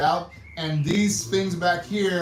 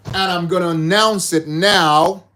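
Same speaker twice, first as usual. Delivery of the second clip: loud hype; 9 dB louder than usual. Real, not acted. Hyping up the prize announcement.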